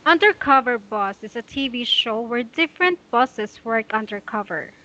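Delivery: undercover boss read in one flowing phrase; smooth fluent read. Shown as a demonstration. Teacher modelling fluent reading without pauses for student. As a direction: read straight through without stopping. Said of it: "Just like this".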